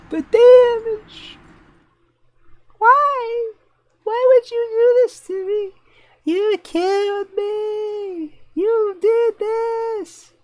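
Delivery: shrill, squealing high cry of mock despair; hammed-up anguished wail. Streamer wailing at the player who killed him, hammed-up high wail. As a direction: wail like you've been betrayed.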